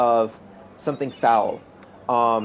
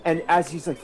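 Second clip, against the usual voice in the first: usual speaking voice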